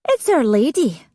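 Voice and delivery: ordinary delivery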